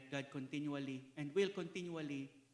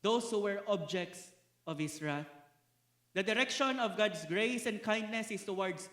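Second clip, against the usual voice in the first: loud, insistent preacher's litany; rising pulpit indictment; forceful preaching; 3 semitones higher, 6 dB louder than usual. Acted. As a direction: hammer each "those who" home.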